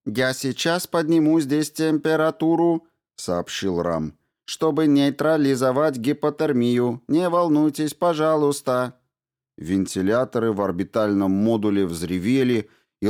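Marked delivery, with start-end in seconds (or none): 0.0-2.9 higher, even machine voice; robot voice. A narrator's reading. Ram the robot tells the crew he is warming the module, even and mechanical. 4.5-9.0 higher, even machine voice; robot voice. A narrator's reading. Ram the robot tells the crew he is warming the module, even and mechanical.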